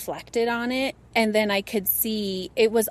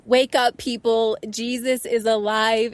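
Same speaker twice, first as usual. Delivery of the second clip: proclaiming from a mountain; 5 dB louder than usual. Pretend. Guest imagines calling out from a mountain, loud proclamation. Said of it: "go climb a mountain and say".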